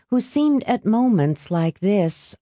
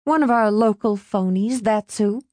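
pointed, slightly higher retort; warning tone; 4 semitones higher than usual. A narrator's reading. Mother to Norm at dinner, warning tone. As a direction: deliver it as a warning. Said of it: "her tone all the warning".

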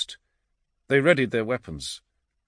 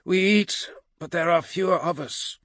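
high, strained warrior voice; warrior character; 7 semitones higher than usual. A narrator's reading. Skull counts the dwindling band, strained warrior voice. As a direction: push it out as a grim warrior.

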